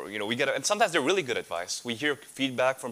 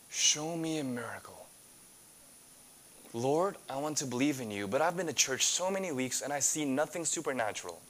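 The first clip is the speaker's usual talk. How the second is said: quiet pleading prayer; 3 dB quieter than usual. Acted. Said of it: "here might be our mindset".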